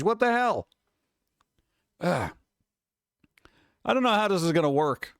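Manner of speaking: ordinary talk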